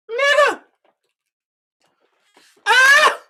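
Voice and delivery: very high, loud yell; shocked yell. Real, not acted. Streamer reacting to live drama, shrieking high and loud. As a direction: scream out in shock.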